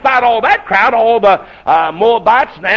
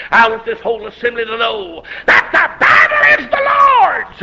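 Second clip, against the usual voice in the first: bold proclamation; 7 semitones higher than usual. Retold or imitated. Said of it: "David spoke and said".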